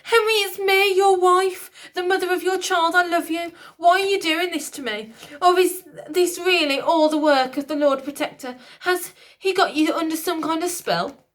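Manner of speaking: high, loud begging queen; high loud desperate plea; desperate pleading queen. A narrator's reading. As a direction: beg the king, frantic. Queen begging as dragged away.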